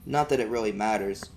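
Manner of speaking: ordinary delivery, nothing marked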